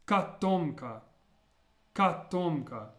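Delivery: "katomka" with an ah first, stressed rounded O second; unstressed O as A. Shown as a demonstration. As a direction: say "katomka" as kah-TOM-ka. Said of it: "first O is pronounced more like an A".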